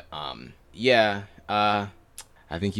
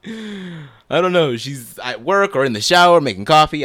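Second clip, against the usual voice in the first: mock dismissive; 5 semitones higher, 12 dB louder than usual. Real, not acted. Mock answer about mom.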